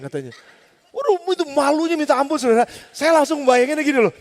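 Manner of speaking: normal talking voice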